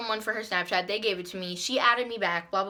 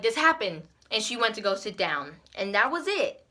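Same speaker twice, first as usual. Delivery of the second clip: normal talking voice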